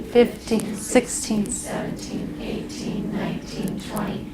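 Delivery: counting softly, trailing off into a whisper; fading into a whisper. Shown as a demonstration. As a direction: let the numbers fade to a whisper.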